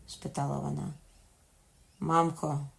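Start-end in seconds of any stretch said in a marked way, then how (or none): none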